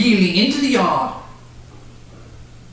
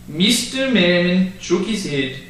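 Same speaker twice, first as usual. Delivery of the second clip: plain reading voice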